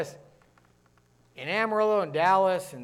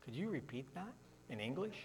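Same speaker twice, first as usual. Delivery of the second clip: soft, lower, puzzled request; baffled listener; 5 semitones lower, 20 dB quieter than usual. Retold or imitated. Listener asking the fast-talking Yankee to repeat, softly baffled. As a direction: quietly mimic someone who can't follow. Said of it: "somebody look at me like".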